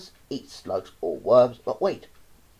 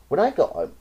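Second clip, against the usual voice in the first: ordinary delivery, nothing marked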